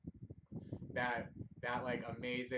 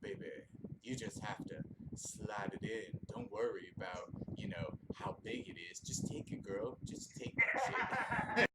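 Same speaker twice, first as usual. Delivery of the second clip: James in Mac Daddy mode. Retold or imitated. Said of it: "Mac Daddy mode".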